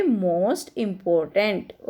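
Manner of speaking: each word spaced out slowly for the class; slow dictation. Shown as a demonstration. Teacher to her students, slow dictation. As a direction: dictate it slowly so children can write.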